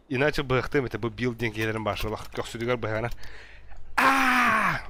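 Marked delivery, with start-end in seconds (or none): 4.0-4.8 sharp high yell of alarm; high scream. Real, not acted. Gamer screaming in game.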